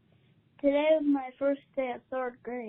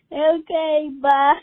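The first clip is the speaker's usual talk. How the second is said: loud exasperated goodbye; 2 semitones higher, 11 dB louder, slower than usual. Retold or imitated. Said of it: "they even just go like".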